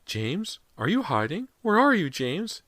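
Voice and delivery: mother calling her missing son in fear; trembling voice. A narrator's reading. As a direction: let the fear build as you call. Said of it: "Missus Fraser's voice trembled".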